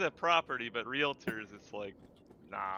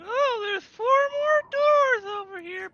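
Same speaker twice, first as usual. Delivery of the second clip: goblin voice; slower than usual. Acted. Goblin character voice.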